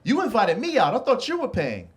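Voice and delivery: mock-furious date demanding he pay; high, shocked complaint. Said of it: "she lost her mind".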